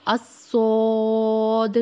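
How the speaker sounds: صاد starting with a hiss, then thick and full-mouthed, said loud and slow; hiss, then fill the mouth and land the final د; heavy, drawn-out swad. Shown as a demonstration. Teacher models the heavy Arabic صاد loudly and slowly. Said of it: "دال کی آواز جو ہے وہ proper آنی چاہیے".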